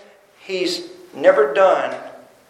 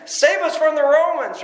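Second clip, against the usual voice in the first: imitating the crowd's scream; 7 semitones higher than usual. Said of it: "as they're screaming out to him".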